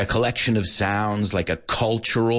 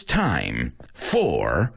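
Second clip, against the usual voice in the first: dramatic slow; 10 semitones lower, much slower than usual. Acted. Countdown to show start.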